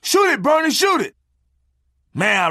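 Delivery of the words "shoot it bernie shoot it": high, loud urgent yells; hunting buddies yelling at Bernie to fire, loud and high; imitating screaming hunters. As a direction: shout it like the whole hunting party is panicking. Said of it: "Everybody was screaming".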